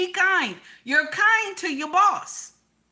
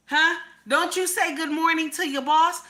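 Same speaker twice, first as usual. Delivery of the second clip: ordinary talk, nothing marked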